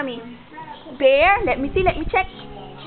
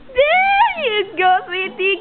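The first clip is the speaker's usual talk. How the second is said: high delighted cry; excited praise; 6 semitones higher than usual. Real, not acted. Praising toddler for finding toy.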